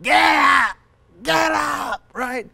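high, strained shout, repeated; shouting coach. Retold or imitated. Rocky's coach urging the fallen boxer up, shouting. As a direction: yell it like a coach at a downed fighter.

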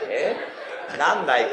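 ordinary delivery

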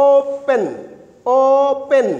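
"ওপেন" as two separate beats, ও and পেন; split syllables. Shown as a demonstration. Teacher counting two syllables for students, "ওপেন" split as ও-পেন. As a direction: draw out the ও in "ওপেন", then say পেন. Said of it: "অর্থাৎ দুইটা syllable আছে".

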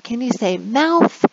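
put-on bright, slowed teacher voice modelling words for kids; upbeat online English teacher; cheery teacher voice. Shown as a demonstration. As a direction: coax little kids to repeat the word. Demo of online class teaching.

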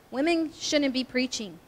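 ordinary delivery

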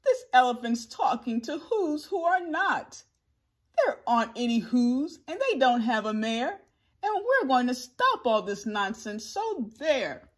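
loud, scornful scolding; shouting. A narrator's reading. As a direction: shout them down as bossy monkeys. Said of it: "The Wickersham brothers were shouting".

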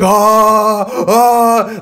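loud, high hammed-up shriek of outrage; hammed-up outraged shriek; mock outraged scream. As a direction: shriek like you just found an insult. Retelling his outraged scream.

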